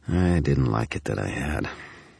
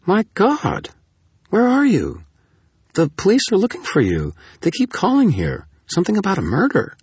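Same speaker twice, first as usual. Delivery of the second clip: very high, louder woman character voice; urgent, astonished questioning pitched way up; alarmed; 5 dB louder than usual. A narrator's reading. Susan alarmed at wanted friend's call.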